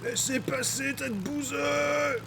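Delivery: hammy shout. Pretend.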